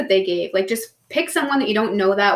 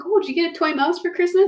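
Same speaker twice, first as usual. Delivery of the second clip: cooing at pet; 8 semitones higher than usual. Retold or imitated. Talking to family's cat.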